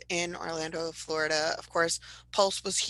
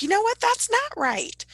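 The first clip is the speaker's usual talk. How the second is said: indignant Southerner, pitched way up and stretched out; Southern accent; 6 dB louder than usual. Pretend. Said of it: "have to have a Southern accent".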